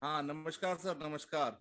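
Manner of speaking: faint, distant voice on the call; barely audible. Real, not acted. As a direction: far from the microphone. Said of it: "आपकी आवाज कम आ रही है".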